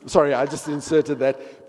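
ordinary talk, nothing marked

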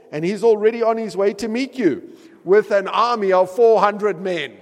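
rising dramatic scripture reading; excited reading. Acted. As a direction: ramp up toward the army. Reads scripture, building.

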